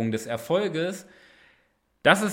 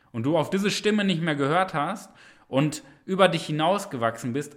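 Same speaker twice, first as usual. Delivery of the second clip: usual speaking voice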